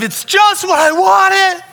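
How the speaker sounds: high, loud mock shriek of joy; slow, gleeful top-of-the-lungs holler; excited kid's scream. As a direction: yell it like a child with a dream present. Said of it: "I just scream".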